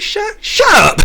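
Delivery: high, loud burst; not under breath. Retold or imitated. Telling the elder off for the first time, blurted loudly. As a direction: blurt it out in a high-pitched mock yell. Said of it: "Kind of under my breath, but not really".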